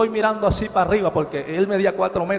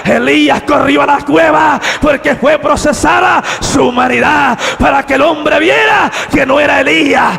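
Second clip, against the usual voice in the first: loud, rising sermon cry; preaching at full force; 6 semitones higher, 9 dB louder than usual. Real, not acted. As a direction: preach it out to the congregation. (predicación a todo pulmón)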